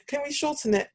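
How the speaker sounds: high-pitched imitation of a request; people asking. People wanting a shorter name, mock high-pitched asking. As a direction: ask it high, as a mock outsider. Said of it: "They'll be like".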